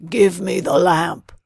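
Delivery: loud demanding shout; old man shouting for the lamp. A narrator's reading. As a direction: bellow the demand. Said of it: "the old man shouted".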